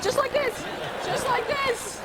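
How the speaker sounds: squeaky high imitation of the Irish fan; high piping imitation of a pub fan; high mimic of a man. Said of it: "he said".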